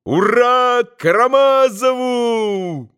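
high, slow jubilant cry building to a long hurrah; soaring, stretched-out boyish exultation; rapturous shout. A narrator's reading. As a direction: cry out as an elated boy, climaxing in a cheer. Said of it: "Ещё раз восторженно прокричал Коля".

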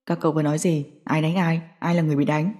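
quiet menace, chilling question; cold voice. Acted. Thế Hải coldly questions the two gossiping men. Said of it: "Giọng nói lạnh lẽo này".

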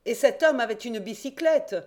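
exclaiming. A narrator's reading. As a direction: blurt out the discovery. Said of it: "s'écria le reporter".

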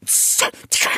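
unvoiced hushing whisper; urgent hush. A narrator's reading. Rabbit hushes Alice's question about the duchess, whispered. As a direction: hiss it to silence someone.

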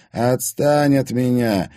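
ruthless. A narrator's reading. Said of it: "безжалостно сказал Седачёв".

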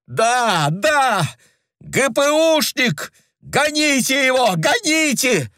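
very high, screaming demand; woman screaming at the visitor; furious shouting. A narrator's reading. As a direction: scream to drive him out. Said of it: "кричала Лёля".

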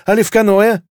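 high, gleeful questioning; delighted, grinning subordinate. A narrator's reading. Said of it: "осклабилась та".

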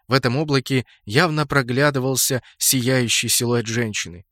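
plain reading voice

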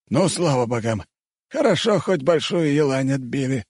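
high-pitched sigh of relief in words; thankful, relieved exclamation. A narrator's reading. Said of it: "облегченно выдохнул Степан".

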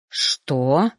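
low, affronted question; indignant. A narrator's reading. Reaper accused of staring, indignant. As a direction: sound convincingly outraged. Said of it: "возмутился жнец".

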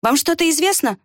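mildly flustered, urgent question; alarmed. A narrator's reading. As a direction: ask with a start of alarm. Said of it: "сполошился Бергц".

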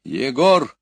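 commanding. A narrator's reading. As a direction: summon sharply, as an order. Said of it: "приказал Кюхельбекер". (громкий повелительный оклик)

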